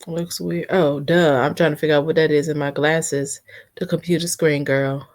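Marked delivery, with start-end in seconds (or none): none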